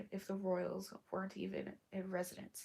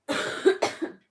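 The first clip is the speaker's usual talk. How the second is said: loud, chesty sick cough; harsh cough; 16 dB louder than usual. Real, not acted. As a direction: cough hard from a sore chest. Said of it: "Still very sick".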